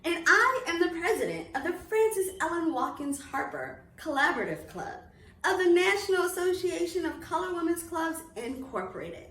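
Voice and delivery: loud, bright announcement energy, like an ad; upbeat promo read. Acted. Club president inviting families to holiday reading series, upbeat promo. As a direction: sell the event to families with big enthusiasm.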